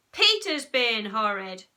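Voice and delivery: loud, slow accusation; indignant child's yell; shouted tale-telling. A narrator's reading. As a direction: shout it back to Mum. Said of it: "shouted Henry".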